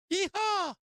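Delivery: very high, loud yeehaw holler; cowboy yeehaw. Real, not acted. Trader hollering yeehaw at his charts, very high. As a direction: holler it like a rodeo win. Celebrating the market squeeze.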